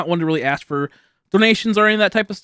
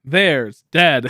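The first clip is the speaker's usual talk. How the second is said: deadpan; 4 dB louder, much slower than usual. Retold or imitated. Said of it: "I'm like".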